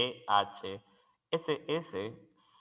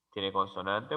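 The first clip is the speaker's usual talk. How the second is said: usual speaking voice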